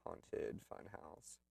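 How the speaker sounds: slow, breathy spooky hush; spooky whisper. Acted. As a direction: whisper it like a ghost story. Spooky description of haunted house.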